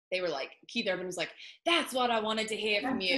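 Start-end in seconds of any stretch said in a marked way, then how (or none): 1.6-3.2 put-on Australian accent. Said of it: "I can't do a good Australian accent".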